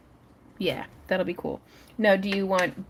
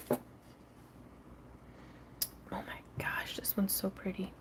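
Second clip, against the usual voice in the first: hushed, admiring whisper; 15 dB quieter than usual. Real, not acted. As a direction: whisper it in awe. Admiring a stone in a hush.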